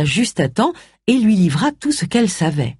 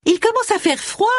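put-on much higher, prying old neighbour; sweet-voiced meddling neighbour; nosy neighbour. A narrator's reading. (voisine curieuse, voix très aiguë)

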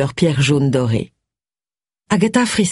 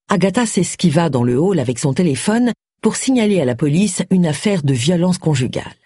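ordinary delivery, nothing marked

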